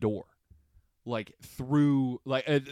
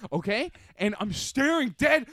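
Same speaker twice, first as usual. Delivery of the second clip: ordinary talk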